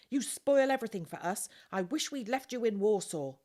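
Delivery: put-on older sister berating her brother, loud; scolding. A narrator's reading. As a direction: let him have it, fed up. Ruth scolding Jan.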